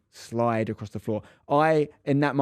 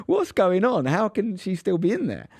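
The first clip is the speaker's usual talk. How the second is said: put-on a small boy totally confused; baffled kid; 7 semitones higher, slower than usual. Boy left at services wonders about mum, high and baffled.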